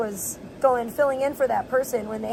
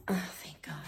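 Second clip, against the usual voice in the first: a whispered sigh of relief; relieved whisper; 6 semitones lower, 10 dB quieter than usual. Real, not acted. Relieved the dog isn't in her way, whispered. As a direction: breathe it out, unvoiced and soft.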